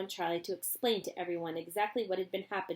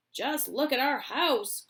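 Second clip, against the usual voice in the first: higher slow dismayed father; cried; 6 semitones higher, 6 dB louder, slower than usual. Acted. Mister Bucket laments his ruined house, dismayed. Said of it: "cried poor Mister Bucket".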